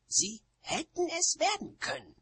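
high, protesting witch voice; high-pitched defensive retort. A narrator's reading. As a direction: defend them in a high, strained voice. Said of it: "verteidigte sich Nanny".

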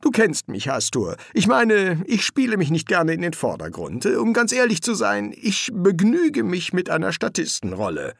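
high, pleading excuses; anxious modesty, pitched high; wheedling reluctant demon. Acted. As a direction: talk your way out of it in a high voice. Crowley pleads to be let off.

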